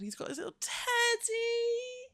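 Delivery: high cooing pet voice. Real, not acted. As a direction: coo at the pup about his teddy. Talking to the dog.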